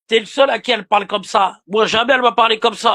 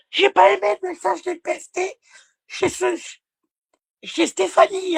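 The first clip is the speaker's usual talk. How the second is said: high-pitched mock female voice; mimicking a woman; 9 semitones higher than usual. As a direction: imitate her in a much higher voice. (imitation moqueuse, voix haut perchée)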